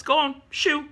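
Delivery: a hammed-up shoo, shoo, dismissive; mock shooing. Professor X sending the X-Men off, mock shooing. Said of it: "then he's like".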